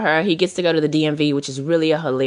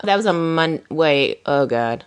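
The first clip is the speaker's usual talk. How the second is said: normal talking voice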